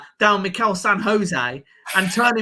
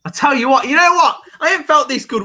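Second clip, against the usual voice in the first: excited; 9 semitones higher, 5 dB louder, faster than usual. Real, not acted. Thrilled fan watching his team.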